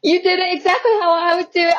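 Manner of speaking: high, badly faked Australian voice; failed Australian impression through laughter; attempted Australian accent. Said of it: "trying to do the Australian accent, but failing miserably".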